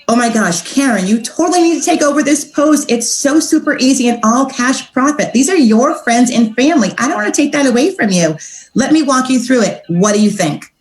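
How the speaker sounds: high, gushing friendly sales pitch; excited pitch. Acted. Coach demonstrating a voice clip for a prospect, excited and high. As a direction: talk it up to her, high and eager. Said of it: "You wanna get them excited".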